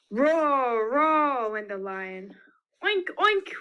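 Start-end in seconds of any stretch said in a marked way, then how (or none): 0.1-1.5 lion roar. Shown as a demonstration. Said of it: "help me with some of these sound effects". 2.8-3.5 pig oink. Shown as a demonstration. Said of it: "help me with some of these sound effects".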